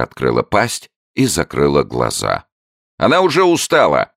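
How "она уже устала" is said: high, loud hurried announcement; hasty showman. A narrator's reading. Showman hastily covering for the newt, high loud barker. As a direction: cut it off quickly. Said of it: "поспешно объявил человечек".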